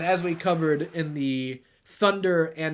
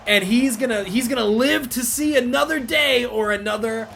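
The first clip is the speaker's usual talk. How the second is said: loud, higher, stretched shout; hyped shouting; 7 semitones higher, 7 dB louder than usual. Real, not acted. Gamer powerbombing the Giant, big hyped shout. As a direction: roar the move like a ringside caller. Calling wrestling moves while playing.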